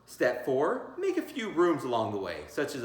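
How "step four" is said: ordinary delivery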